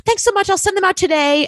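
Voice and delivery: mock chirpy agent promising to mail vouchers; chirpy agent imitation.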